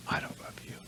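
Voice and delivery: a cold, breathy whisper; hushed, hurtful whisper; whispered reply. Retold or imitated. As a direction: barely voice it, let it hang. Mother's cold reply, retold.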